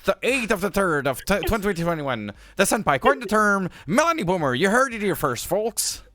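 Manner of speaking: hammed-up announcer voice; mock announcer. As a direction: proclaim it to the crowd like breaking news. Mock announcement of coined term.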